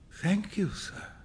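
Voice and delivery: soft, faint thanks; barely voiced gratitude; old beggar. A narrator's reading. Old beggar to the giver of a sovereign, faintly.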